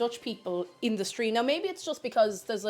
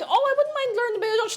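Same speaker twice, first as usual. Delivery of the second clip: high, quick, chirpy self-quote; eager retold remark; 12 semitones higher, 5 dB louder, faster than usual. Streamer quoting herself to Dutch people, high eager rush. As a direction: pitch it up, keen and fast.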